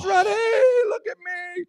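high, long-stretched holler of a name; attention-seeking shout. Retold or imitated. Storyteller imitating his rowdy friend all night long, high drawn-out shout. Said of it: "It's just like".

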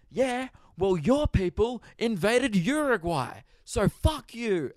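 high-pitched, slow, drawn-out mock outrage; mock indignant commenter. Acting out a commenter attacking someone's people, mock indignant. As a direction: put on a self-righteous high voice. Acts out hostile online commenter.

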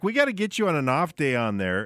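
normal talking voice

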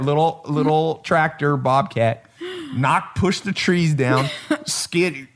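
slow, laughing through words; remembering the Bobcat clearing trees while laughing. Real, not acted. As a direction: chuckle through the story.

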